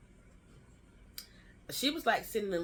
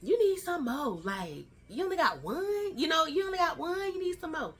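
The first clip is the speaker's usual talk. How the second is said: much higher, pushy mock voice; teasing her about one child; nagging people; 11 semitones higher, 6 dB louder than usual. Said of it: "people be like".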